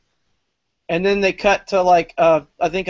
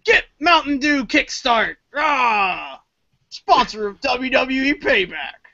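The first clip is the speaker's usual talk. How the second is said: higher, hyped commercial voice; mock ad announcer; 4 semitones higher, slower than usual. Cutting to a sponsor ad, hyped announcer voice. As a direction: cut in like a cheesy sponsor spot.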